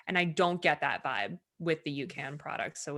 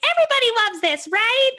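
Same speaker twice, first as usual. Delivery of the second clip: much higher and louder, fast gushing; a hammed-up bubbly fan, high and quick; 10 dB louder than usual. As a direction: gush like everyone surely loves it. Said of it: "so loving and bubbly about everything".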